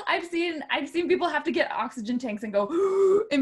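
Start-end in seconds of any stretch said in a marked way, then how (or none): 2.7-3.3 high, loud gasp for air; mock gasp. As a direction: suck in air like you're suffocating. Imitating singers needing oxygen.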